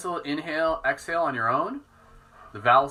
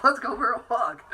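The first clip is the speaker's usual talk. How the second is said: much higher eager dog voice; voicing the dog; 11 semitones higher, 3 dB louder than usual. Owner voicing her dog begging for a walk. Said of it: "Dallin like".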